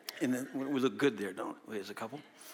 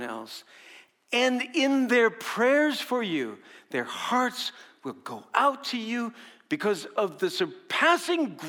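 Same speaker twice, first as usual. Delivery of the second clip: raised, fervent Bible reading; scripture reading; 6 semitones higher, 5 dB louder than usual. Acted. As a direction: read Paul's words as a proclamation. Reads Second Corinthians aloud.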